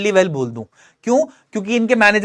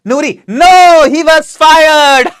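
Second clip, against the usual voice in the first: higher, louder, very slow; Samantha's voice; 7 semitones higher, 9 dB louder, much slower than usual. Acted. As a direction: spell out the bad news in character. Samantha's reply in voice. (ऊँची, ज़ोरदार, धीमी आवाज़ में)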